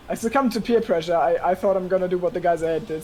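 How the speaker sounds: ordinary talk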